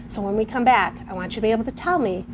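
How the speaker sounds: ordinary talk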